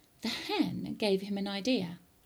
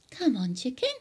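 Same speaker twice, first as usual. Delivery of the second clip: put-on higher, encouraging boy's voice; cajoling George, raised pitch; coaxing a hen; 5 semitones higher than usual. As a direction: urge the chicken over.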